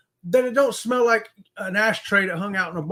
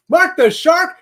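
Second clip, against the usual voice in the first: excited shout-out; 8 semitones higher, 9 dB louder, slower than usual. Real, not acted. Host shouting out a longtime subscriber, excited and loud. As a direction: Mark the Shark drawn out high and loud with a laugh. Host calling out a viewer's name.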